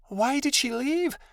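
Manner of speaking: high, slow, hurt little voice; quiet questioning. A narrator's reading. Adelaide asks why her friend left, in a small high voice. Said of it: "Adelaide questioned quietly".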